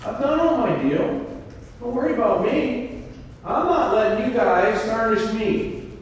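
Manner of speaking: high, bright, proud declaration; confident David. Retold or imitated. David answers his mocking brothers, high and proud. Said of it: "That's what David said".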